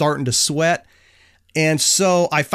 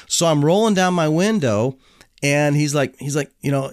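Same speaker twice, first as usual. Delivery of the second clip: ordinary talk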